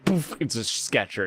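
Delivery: normal talking voice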